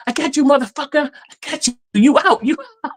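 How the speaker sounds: high, taunting mock trash talk; hammed-up gloating at a paintball victim; cussing player. Retelling a paintball player taunting opponents, high and mocking. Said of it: "always cussing at people like".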